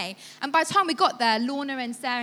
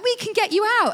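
eager offer; 9 semitones higher, 5 dB louder than usual. Retold or imitated. Teen girls offer rescue.

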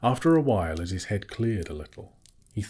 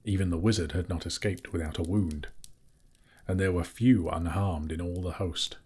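plain reading voice

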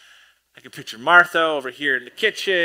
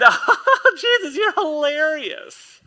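much higher, slow, laughing through it; laughing gush; 10 semitones higher, 4 dB louder, much slower than usual. Retold or imitated. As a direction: gush over his jokes. Mary gushing with laughter.

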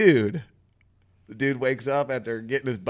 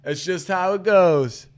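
higher, slow, drawn-out catchphrases; easygoing catchphrase guy; 6 semitones higher, 4 dB louder than usual. Acted. Podcaster imitating the groom who says it over and over, slow high catchphrases. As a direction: stretch each stock phrase out, pitched up. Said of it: "he's the guy who says".

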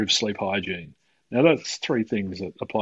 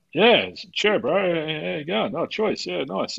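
higher-pitched, put-on New Zealand accent; heavy Kiwi accent; 7 semitones higher than usual. Imitating himself back from the pub job, heavy Kiwi accent. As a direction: rattle off Kiwi small talk in accent. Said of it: "a heavy Kiwi accent".

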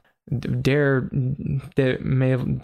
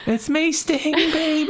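very high put-on character voice; hammed-up falsetto swagger; 14 semitones higher than usual. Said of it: "What is this voice?".

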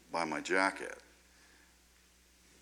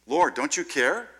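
raised, louder cry of alarm; freaking out; 7 semitones higher, 9 dB louder than usual. Acted. Panicked disciples calling to Jesus in the storm, freaking out. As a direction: plead in a loud mock panic. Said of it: "Disciples are freaking out".

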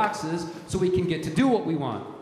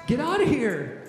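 God rebuking the accuser; 5 semitones higher than usual. Acted. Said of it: "And he says to you".

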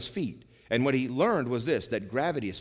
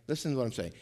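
usual speaking voice

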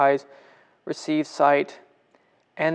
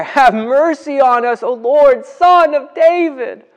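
cry out even more; 9 semitones higher, 10 dB louder than usual. Retold or imitated. Blind men crying out louder to Jesus, loud and higher. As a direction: shout for mercy over the crowd. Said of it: "They cry out even more".

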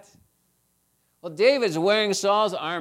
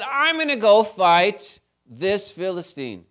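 upset vow; 5 semitones higher, 8 dB louder, slower than usual. Retold or imitated. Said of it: "David was so upset".

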